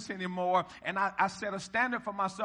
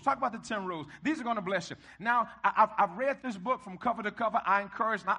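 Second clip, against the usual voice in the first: ordinary talk, nothing marked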